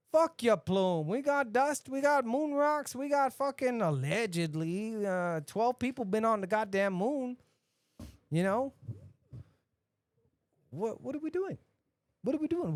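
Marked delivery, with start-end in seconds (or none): 0.1-7.4 loud, high ranting at Musk; louder, higher exasperated rant; ranting shout. Real, not acted. 10.7-12.7 exasperated. Real, not acted. Exasperated repeated question.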